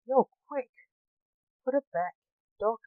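ordering. A narrator's reading. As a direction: give a lightly acted order. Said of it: "Jack ordered".

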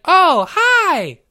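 high, loud "Oh, hi" greeting; chirpy pun greeting shouted high. Pretend. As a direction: belt out "Oh, hi" bright and high. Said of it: "because it's like".

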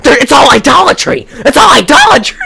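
high, loud insane shouting; Darren. Retold or imitated. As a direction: shriek the accusation like a raving zealot. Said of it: "He sounds like he's insane".

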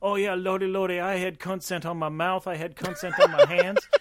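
high, loud hammed-up Seagal; mock Seagal. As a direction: ham up a clueless Seagal. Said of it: "mock Seagal answering".

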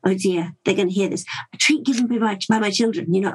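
ordinary delivery

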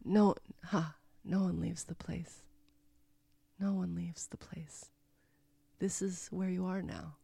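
hushed, breathless and ominous; spooky retold whisper from the swamp man; creepy whisper. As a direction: whisper it like a secret curse.

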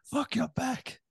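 high, put-on dismissive snap; mock Goggins. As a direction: brush the excuse off. Goggins reply.

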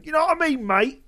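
loud, high, hammed-up Cockney; mock Cockney accent.

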